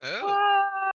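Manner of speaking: high loud gasp of wonder; amazed shout. Real, not acted. Reacting to the crossbow.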